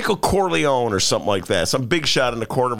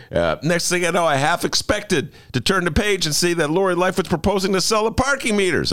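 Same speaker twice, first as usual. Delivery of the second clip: ordinary talk, nothing marked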